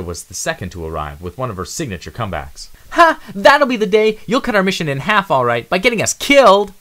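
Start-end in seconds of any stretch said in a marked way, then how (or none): 2.9-6.7 high, loud, scoffing retort; loud sassy female mouse; mocking comeback. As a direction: shoot him down with a laugh.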